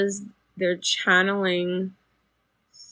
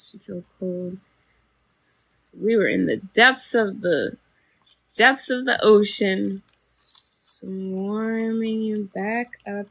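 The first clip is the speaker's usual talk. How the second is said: a calm guide's voice, swelling louder at the depths; guided meditation; 4 dB louder than usual. Acted. Guiding a listener up from the ocean, calm then swelling. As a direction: lead them up from the ocean depths.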